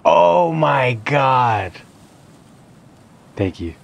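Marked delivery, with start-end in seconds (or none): 0.1-1.7 loud, high, drawn-out disbelief; amazed shout. Real, not acted. Player finally landing the jump, amazed shouting. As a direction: yell in amazement, stretching the words.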